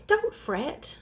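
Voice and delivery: a tiny mouse soothing the bear. Acted. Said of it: "Mouse speaks".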